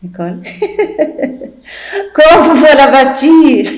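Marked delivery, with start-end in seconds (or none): 0.5-1.5 breathy high snicker; stifled giggle. Acted. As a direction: laugh behind the hand. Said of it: "Nicole n'arrête pas de rire".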